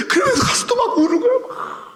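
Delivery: half laugh, half sob; crying laugh-sob. Real, not acted. Preacher overcome again telling Joseph wept, laugh-sobbing.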